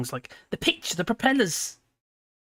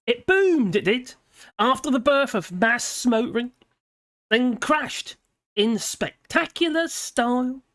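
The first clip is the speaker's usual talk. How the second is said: mock-grand essay reading, deliberately phrased; measured delivery with drawn-out key phrases; scripted read; 5 semitones higher, slower than usual. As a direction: read it out like a presenter delivering a column. Reads out a prepared script.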